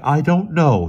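high, loud admission; said slowly. A narrator's reading. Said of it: "he said slowly".

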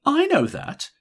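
high, loud, quick cut-in; older lady hurrying to agree; quick interjection. A narrator's reading. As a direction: jump in fast before she finishes. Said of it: "Missus Silsburn said quickly".

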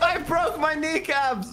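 high slow drawn-out shout; mock wail.

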